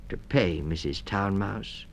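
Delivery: ordinary delivery, nothing marked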